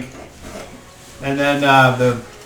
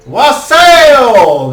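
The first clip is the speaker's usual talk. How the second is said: high, loud toast shout; toast call; 11 semitones higher, 11 dB louder than usual. Acted. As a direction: call the hall to drink. Lord calls a toast.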